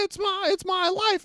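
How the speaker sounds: high, strained mock scream-singing; mock screaming lyrics. Punk friend screaming lyrics in his girlfriend's ear, high mock scream. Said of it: "screaming in his girlfriend's ear".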